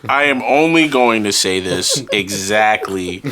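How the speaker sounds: low, deliberate announcement; mock-grave, measured pronouncement; solemn declaration. Solemn restatement after laughing first attempt.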